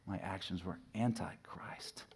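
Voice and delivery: soft slow whisper. Real, not acted. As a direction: confess it under your breath. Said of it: "whispered confession".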